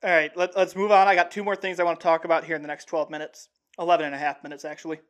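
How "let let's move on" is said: normal talking voice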